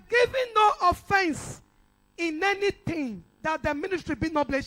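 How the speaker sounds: scripture quote. Shown as a demonstration. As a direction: intone the Bible verse with weight. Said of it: "Bible said".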